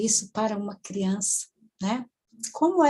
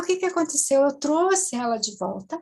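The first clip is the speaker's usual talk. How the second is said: ordinary talk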